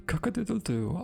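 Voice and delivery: high crowing call; rooster crow. Acted. Rooster crows.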